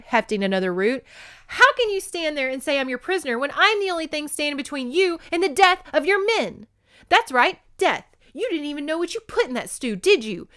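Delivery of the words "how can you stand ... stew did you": high, loud angry rant; indignant tirade. Acted. Woman scolds captor for poisoning his men, high and angry. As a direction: tear into him, outraged.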